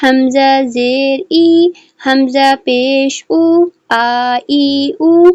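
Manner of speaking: slow, drawn-out syllable drill; slow drill reading. Shown as a demonstration. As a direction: read each sound slowly and clearly like a Qaida teacher. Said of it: "اس کو ہم ریڈ کریں گے".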